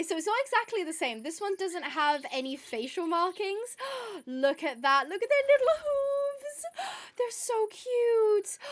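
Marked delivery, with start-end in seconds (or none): 5.1-8.6 high, slow cooing over something tiny. Real, not acted. Gushing to the newborn foal, high slow coo. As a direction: melt over the little hooves.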